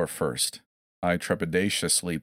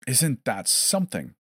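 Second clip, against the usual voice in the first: cheerful; 6 semitones higher than usual. A narrator's reading. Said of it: "exceedingly cheerful now".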